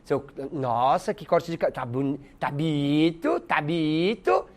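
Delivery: mock admire a bad haircut slowly; slow ironic drawl. Teacher mocking a haircut with ironic praise. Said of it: "É a ironia".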